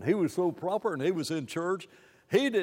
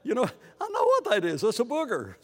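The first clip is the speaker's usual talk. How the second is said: higher, quick excited quoting; excited retold question; 7 semitones higher, 4 dB louder, faster than usual. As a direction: blurt it out eagerly. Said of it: "I asked in New Zealand".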